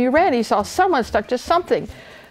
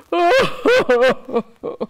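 high, loud wailing sob; great hammed-up sob; crying sob; 11 semitones higher, 4 dB louder than usual. A narrator's reading. Old king breaks down, sobbing. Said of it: "The old king was crying".

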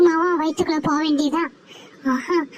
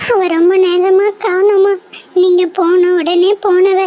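put-on a girl begging her mother to go and look; loud tearful explanation to mother; upset child explaining; 2 semitones higher, 6 dB louder than usual.

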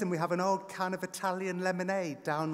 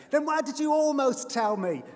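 put-on high, loud child's yell; 11 semitones higher, 10 dB louder than usual. A narrator's reading. Son yelling at dad in the car, loud and high. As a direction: yell it in outrage. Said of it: "Joel yells".

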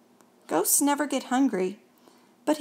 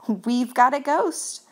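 proudly; 3 semitones higher, 3 dB louder, slower than usual. A narrator's reading. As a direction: boast it slowly. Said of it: "said the Scotts proudly".

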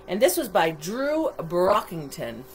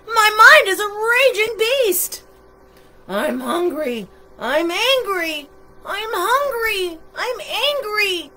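hangry monster, ranting; 10 semitones higher, 6 dB louder than usual. A narrator's reading. Monster with no food ranting at everyone, mounting high-pitched tantrum. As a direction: whine and rage like a hungry monster. Monster rants while hungry and angry.

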